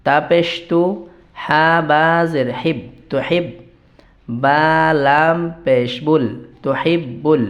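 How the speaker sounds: slow syllable-by-syllable spelling, intoned recitation; Arabic pronunciation drill. Shown as a demonstration. Teacher building the dua for learners, slow chanted drill. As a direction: chant the joined phrases slowly, holding long vowels. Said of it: "আরবিটা কিভাবে উচ্চারণ করব".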